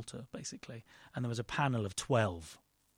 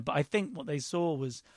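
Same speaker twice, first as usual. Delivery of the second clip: ordinary delivery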